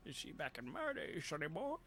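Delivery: put-on high, creaky old-timer voice; grumpy grandpa reminiscing; very old man. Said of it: "I shouldn't try to do voices".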